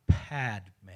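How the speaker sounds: PADME as "PAD-may", first syllable stressed; Tibetan pronunciation. Shown as a demonstration. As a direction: sound out Padme in two parts, PAD-may. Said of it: "This is a Tibetan pronunciation".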